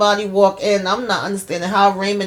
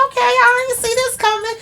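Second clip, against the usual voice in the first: much higher, squeaky disbelief; surprised squeal. Real, not acted. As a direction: pitch way up like the twist caught you off guard. Own reaction to plot twist.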